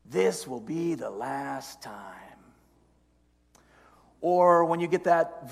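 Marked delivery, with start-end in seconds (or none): 0.0-2.2 soft, coaxing tempter's voice; devil on the shoulder. Acted. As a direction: coax quietly, slowing at the end. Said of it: "the second voice jumps in and says".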